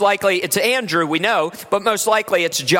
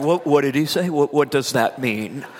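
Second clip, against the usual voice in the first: lower, puzzled voice of baffled locals; confused Californians; 7 semitones lower than usual. Retold or imitated. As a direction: play the confused listener, a bit lower. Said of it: "And everybody's like".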